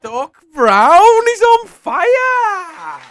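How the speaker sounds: very high, stretched-out roar; announcer's triumphant shout. Pretend. Host celebrates the contestant's winning streak, drawn-out excited shout. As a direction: bellow it like a commentator, holding the vowels.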